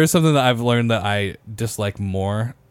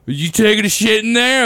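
put-on drunk guy; 8 semitones higher than usual. Retelling a drunk stranger at the bar bathroom, higher imitated call. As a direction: call through the stall door like a nosy drunk. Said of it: "this guy's like".

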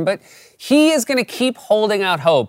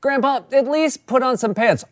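higher-pitched exasperated plea; pleading relative; 10 semitones higher than usual. Acted. Family member to grandpa in bathrobe, exasperated plea. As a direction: beg him in a raised, exasperated voice.

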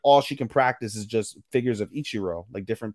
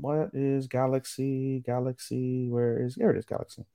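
absent-minded searching; 6 dB quieter than usual. Real, not acted. Podcaster looking up a character's name, absent-minded. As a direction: mumble along while looking something up.

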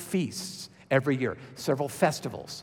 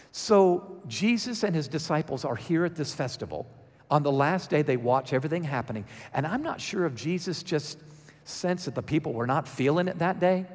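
normal talking voice

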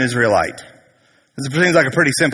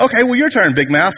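higher-pitched sneering retort; mock challenge; 7 semitones higher, faster than usual. Prophets challenging Elijah back, higher mock taunt. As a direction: throw the dare back, hammed up.

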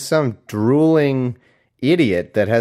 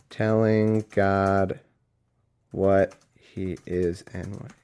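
very slow, halting, word by word; dictating while typing; 3 semitones lower, 4 dB quieter, much slower than usual. Real, not acted. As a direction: speak at typing speed. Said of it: "dictate the notes that I'm typing".

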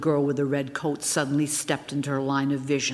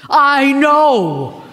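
loud, much higher exclamation; impatient snap; 10 semitones higher, 11 dB louder than usual. A narrator's reading. Star brushing off her friend's remark, loud and high. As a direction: cut her friend off sharply. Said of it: "flicked my remark aside impatiently".

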